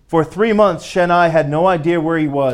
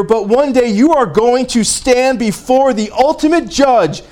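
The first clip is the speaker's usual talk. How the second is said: higher, louder bold preaching voice; preaching at full force; 7 semitones higher, 4 dB louder than usual. Retold or imitated. Woman boldly preaching to her angry interrogator, loud and higher.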